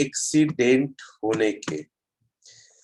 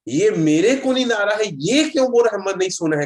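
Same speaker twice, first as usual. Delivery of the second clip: higher, louder irritated complaint; annoyed retort; 4 semitones higher, 4 dB louder than usual. Retold or imitated. Sleepy passenger protesting to the driver, irritated raised voice. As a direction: grumble back, fed up at being woken. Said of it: "मैं गुस्सा आ गया अंदर से".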